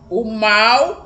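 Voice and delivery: ordinary talk, nothing marked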